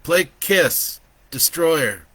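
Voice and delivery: loud and high, word by word; shouted command. A narrator's reading. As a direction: call the order out to a voice assistant. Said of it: "he shouted to his A I".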